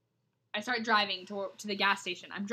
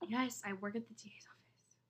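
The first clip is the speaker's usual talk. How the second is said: breathy unvoiced whisper; hushed aside; 10 dB quieter than usual. Real, not acted. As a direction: drop to a conspiratorial whisper. Deadpan reply about illegal act.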